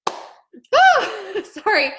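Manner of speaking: high "ah", then apologetic little laugh; choking up and laughing it off; emotional gasp. Real, not acted. Woman recalling her mom's words, high emotional gasp and sorry. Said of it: "I can get emotional about it".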